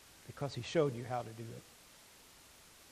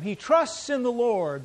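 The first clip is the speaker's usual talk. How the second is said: mocking; 8 semitones higher, 7 dB louder, slower than usual. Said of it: "they're mocking him".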